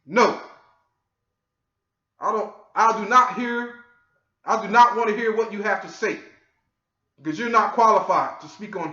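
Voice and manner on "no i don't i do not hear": normal talking voice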